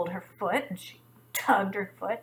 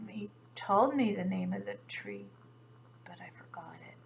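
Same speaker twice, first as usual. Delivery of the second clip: whispered confession, fading away; ashamed whisper; 2 semitones lower, 6 dB quieter than usual. A narrator's reading. Gazelle confessing to the animals, whispered and ashamed. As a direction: breathe it out as if embarrassed.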